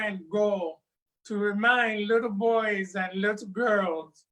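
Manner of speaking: lively, sing-song folktale telling; storyteller spinning a West African folktale; story narration. A narrator's reading. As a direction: tell it to a circle of children.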